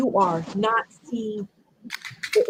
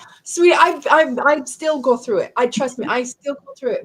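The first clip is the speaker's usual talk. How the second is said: tearful; 6 semitones higher, 4 dB louder than usual. Real, not acted. Emotional host comforts crying guest.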